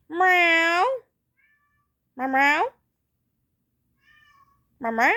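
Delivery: playful meow at the cat, high and loud. Pretend. Speaking to her cat, loud high playful meowing.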